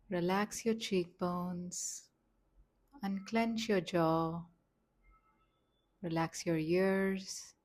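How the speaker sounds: slow, gentle meditation voice with long pauses; softly paced relaxation instructions, leaving space to breathe; guided meditation. Acted. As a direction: guide them calmly, phrase by unhurried phrase.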